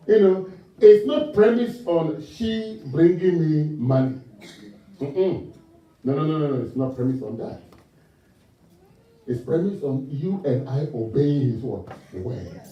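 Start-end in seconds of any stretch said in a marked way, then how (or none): none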